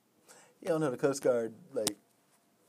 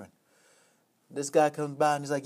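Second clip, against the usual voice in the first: usual speaking voice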